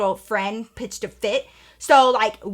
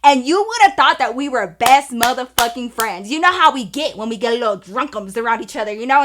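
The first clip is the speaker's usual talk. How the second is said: ordinary talk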